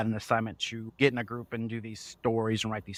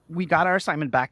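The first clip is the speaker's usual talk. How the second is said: ordinary talk, nothing marked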